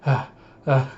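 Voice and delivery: panting gasps; a few breathless gasps; out of breath. Retold or imitated. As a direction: pant it like you ran too far. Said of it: "has run out of breath".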